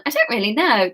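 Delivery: normal talking voice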